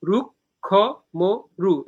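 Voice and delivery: "রুক্ষ মরু" broken into separate beats; syllable by syllable. Shown as a demonstration. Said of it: "এই চার মাত্রা একটা পর্ব".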